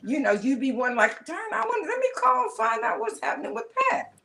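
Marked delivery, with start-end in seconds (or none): 1.2-4.1 high wondering voice; musing aloud, pitched way up; imagined quote. Retold or imitated.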